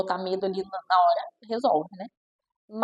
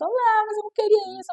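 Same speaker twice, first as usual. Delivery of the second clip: put-on much higher, child complaining; child whining over the meal, higher; whiny child; 11 semitones higher than usual. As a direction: whine like an upset child. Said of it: "A criança pode estar até chorando".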